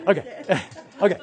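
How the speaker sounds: laughing it off, embarrassed; laughing okay. Real, not acted. Teacher to class after a joke, laughing. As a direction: chuckle through the okay.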